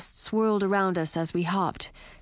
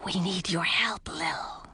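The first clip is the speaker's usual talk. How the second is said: slow whispered snake voice; hissing snake whisper; 4 dB quieter, slower than usual. A narrator's reading. Fang the snake's voice.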